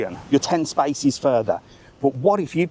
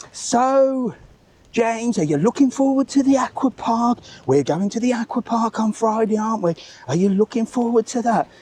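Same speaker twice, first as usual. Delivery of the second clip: high, sing-song loud mum voice; mock loud mum; 9 semitones higher than usual. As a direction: lampoon a mother performing for the whole bus. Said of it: "this woman was talking so loud to her kid".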